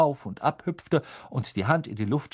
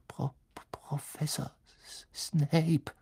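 stammered, barely audible whisper; frightened whisper; 5 semitones higher, 4 dB quieter, slower than usual. A narrator's reading. Scared pupil whispering the feared teacher's name. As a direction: whisper the name in fear, stumbling. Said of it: "kaum vernehmlich flüsternd".

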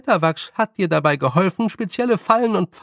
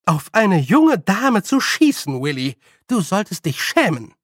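higher, emphatic telling-off; stern scolding; 6 semitones higher, 4 dB louder than usual. A narrator's reading. Nanny scolds old man for shooting, stern. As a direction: scold sternly, leaning on the words. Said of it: "sagte Nanny Ogg streng".

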